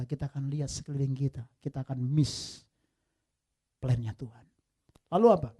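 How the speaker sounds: ordinary talk, nothing marked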